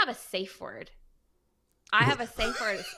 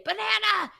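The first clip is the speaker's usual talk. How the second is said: put-on sharp, loud call of the word to stop a yelling teen; called-out safe word; 11 semitones higher, 6 dB louder than usual. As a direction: cut him off with one loud word. Mom calling out the safe word.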